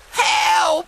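high terrified scream for help. Acted. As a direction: shriek it in panic. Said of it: "Beanie screamed".

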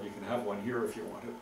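usual speaking voice

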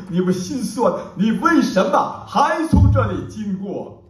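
declaimed verse, swelling into urgent questions and falling back to hush; impassioned poem recitation. Acted. Poet shares a short, powerful poem with an audience, declaimed passionately. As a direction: recite with dramatic surges and hushed pauses.

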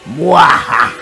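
loud evil villain laugh; evil cackle. Acted.

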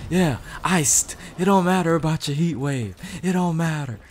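high, cocky trash talk; taunting the beaten enemy; gloating taunt. Real, not acted. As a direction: rub it in after the kill. Gloating over a kill.

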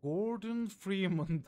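announcing himself as a game hero; Gordon Freeman. Pretend. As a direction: intone the hero's name like a game intro. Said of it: "Half-Life bir N P C'si gibiyim".

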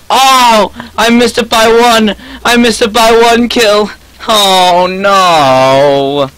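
loud, high, drawn-out cry of defeat; real dismayed shouting, long held vowels; anguished yell. Real, not acted. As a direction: wail it out, slow and loud, as the win slips away. Misses goal by one kill.

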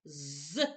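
З as a lone buzzing sound, no vowel name; zzz, the bare sound of З; isolated consonant. Shown as a demonstration. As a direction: buzz the sound З by itself. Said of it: "чуємо звук З".

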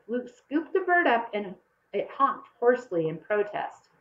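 ordinary delivery

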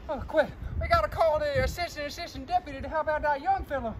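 ordinary delivery

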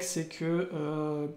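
ordinary talk, nothing marked

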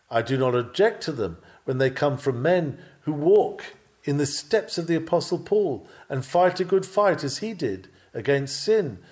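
ordinary talk

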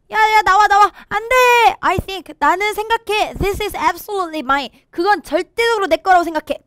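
high, loud, pushy shout; acted yell, high and insistent. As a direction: shout them out of the way. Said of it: "소리를 친 거예요".

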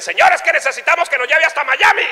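high, fast pleading; urgent plea. Acted. Cubans begging the raft owner, urgent plea.

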